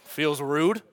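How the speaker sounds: ordinary talk, nothing marked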